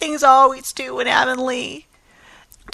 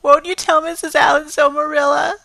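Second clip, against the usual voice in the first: tearful lament; 5 semitones higher, 4 dB louder, faster than usual. Acted. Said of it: "sobbed Anne".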